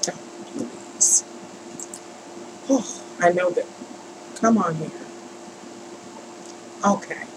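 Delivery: whispered plea under the breath; very soft, voiceless pleading; exasperated whisper. Real, not acted. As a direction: whisper it to the stubborn screen. Pleading under breath with stubborn software.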